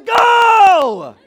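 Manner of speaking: long high shout then yelled go; full-on mock honk and yell; angry horn blast. Impatient driver at green light, loud shouted blast. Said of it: "That's not what I wanna do".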